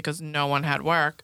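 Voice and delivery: usual speaking voice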